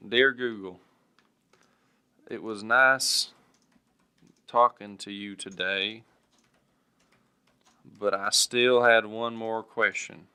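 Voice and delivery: slow, formal, spoken while typing; dictating as typed. Shown as a demonstration. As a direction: read each word as you type it. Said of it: "Here, I'll do it right now".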